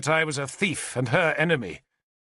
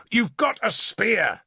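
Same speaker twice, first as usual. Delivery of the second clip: high, slow, contemptuous snarl; 8 semitones higher, slower than usual. A narrator's reading. Lord snarls at the captain, contemptuous anger. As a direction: sneer the blame right back. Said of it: "I snarled".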